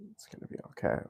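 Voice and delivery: whispered self-reassurance. Real, not acted. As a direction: breathe it out softly.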